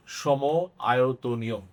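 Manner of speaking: সম আয়তনীয় said slowly, each syllable drawn out; slow for memorizing. Shown as a demonstration. Teacher drilling the term for students, slow and deliberate. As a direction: spell out সম আয়তনীয় slowly so it sticks. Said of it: "মনে রাখবা".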